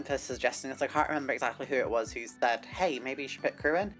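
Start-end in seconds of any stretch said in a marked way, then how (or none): none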